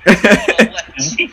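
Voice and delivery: laughing while talking. Real, not acted. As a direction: chuckle through the words. GM laughing at the players.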